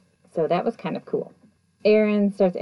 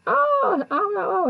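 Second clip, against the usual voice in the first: high strained oh's, much higher; retold frightened cries, pitched way up; mock screaming. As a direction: cry out in pain up high. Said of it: "screaming and, like".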